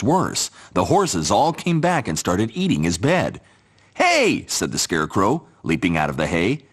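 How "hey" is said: very high sudden yelp; startled hey. Acted. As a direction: jump out startled. Horses eat his bed.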